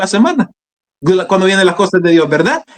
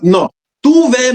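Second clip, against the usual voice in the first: slow, higher-pitched insistence; retold firm command; 6 semitones higher, slower than usual. Holy Spirit sending him to testify, retold firm command. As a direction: cut off the excuse with a slow order. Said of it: "me dijo".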